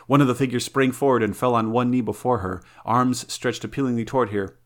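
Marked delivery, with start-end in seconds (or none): none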